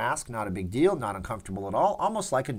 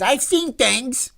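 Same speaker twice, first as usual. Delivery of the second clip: slow, high, loud mock outburst; hammed-up high-pitched cry, drawn out; Fredo impression; 12 semitones higher, 7 dB louder, much slower than usual. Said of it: "Fredo said".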